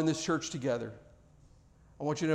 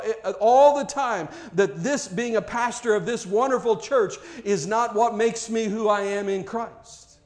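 louder, higher fervent pulpit preaching; full-force preaching; 5 semitones higher, 6 dB louder than usual. Acted. Pastor preaching to his church at full force. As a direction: preach it out, climbing with fervour.

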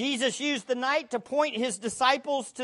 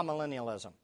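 normal talking voice

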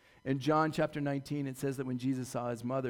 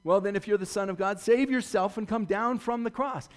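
higher, louder sneering dare; jeering taunt; 6 semitones higher, 6 dB louder than usual. Acted. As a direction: fling it up at him like a heckler. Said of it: "throwing his words back in his face".

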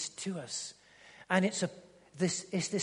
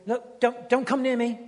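louder mock plea to keep away; fending people off; 5 semitones higher, 8 dB louder than usual. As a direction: push everyone back, half-acted. Acting out someone pushing people away.

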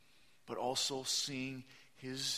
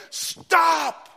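sudden full-voice shout; one loud commanding stop; 18 dB louder than usual. Shown as a demonstration. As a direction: yell it to halt a kidnapper. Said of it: "raise your voice and say".

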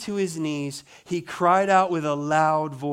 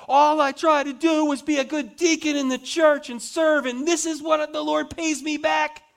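whiny complaining Stephen; 9 semitones higher, 3 dB louder than usual. Pretend. Preacher mocks a martyr who complains about fairness, high whiny voice. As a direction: whine like a wronged child.

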